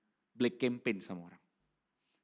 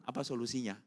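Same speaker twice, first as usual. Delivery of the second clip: usual speaking voice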